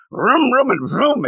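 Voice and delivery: high-pitched mouth-made vrooms; imitated engine revving.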